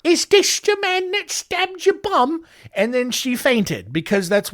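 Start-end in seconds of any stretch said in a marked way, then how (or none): 0.0-2.4 very high mock Cockney question; squeaky hammed-up Cockney, asking urgently; awful high-pitched Cockney. Coleman asks the victim to identify her attacker, high mock Cockney. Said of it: "high-pitched Cockney accent".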